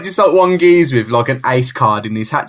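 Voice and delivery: normal talking voice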